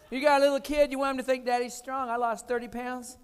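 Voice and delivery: ordinary delivery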